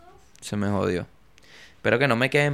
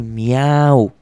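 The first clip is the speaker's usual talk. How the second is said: playful meowing like a cat; 6 dB louder than usual. Pretend. Podcaster playing the cat club, meowing. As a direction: meow like a lazy cat. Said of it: "haciendo como un gato".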